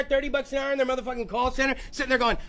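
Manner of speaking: ordinary delivery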